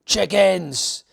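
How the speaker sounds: loud, high Cockney jeer; Cockney bellow. Acted. Goliath taunting the frozen army, loud Cockney bellow. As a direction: sneer it out loud. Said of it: "Goliath bellowed".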